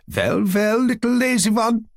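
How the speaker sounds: put-on high, playful German tutor teasing the boy; teasing with a smile. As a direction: tease him with a smile in a German accent. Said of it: "as he said with a smile".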